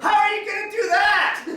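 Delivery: very high shrill yell at the TV; mother yelling. Retold or imitated. Comedian imitating his mother yelling at the TV. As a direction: shout at the television in outrage. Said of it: "my mother in the background like".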